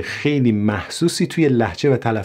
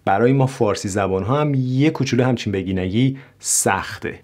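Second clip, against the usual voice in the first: usual speaking voice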